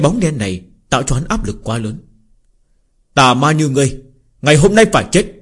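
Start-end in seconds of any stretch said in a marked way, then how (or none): 3.1-5.3 angry shout. Acted. Hero confronts the demon.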